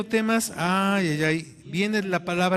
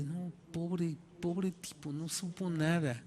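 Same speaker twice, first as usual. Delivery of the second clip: pitying classmates; 10 dB quieter, slower than usual. Retold or imitated. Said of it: "como si yo fuera una víctima".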